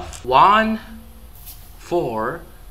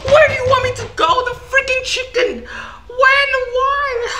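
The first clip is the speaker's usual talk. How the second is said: very high loud fast shriek with laughter; screaming panic; 5 dB louder than usual. Real, not acted. Player killed by the chicken, screaming in panic. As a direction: scream half laughing, half crying. Chicken jumpscare kills him.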